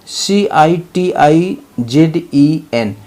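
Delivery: normal talking voice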